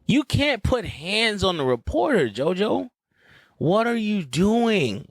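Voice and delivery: slow, stretched-out reproach; mock scolding. Host scolding JoJo for putting hands on a reporter, slowly reproachful. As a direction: spell it out slowly, word by word, in disbelief.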